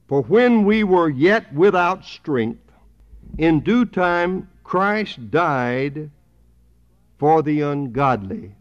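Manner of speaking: slow, emphatic Bible reading; scripture reading. Acted. Preacher reading Romans to the congregation, slow emphatic scripture reading. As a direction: read the verse out loudly, weighing each phrase.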